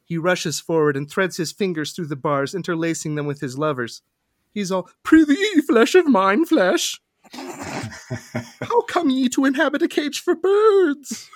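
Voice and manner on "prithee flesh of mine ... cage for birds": much higher, louder, slow and theatrical Olde English; insane archaic lover. Acted. Mycroft greets his caged lover, much higher unhinged archaic voice. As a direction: ham up a swooning madman. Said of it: "don't remember him talking like this".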